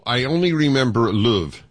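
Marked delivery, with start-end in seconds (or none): 1.1-1.6 "luv" with a Yorkshire short-oo vowel; Yorkshire accent. Shown as a demonstration. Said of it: "able to mimic a Yorkshire accent".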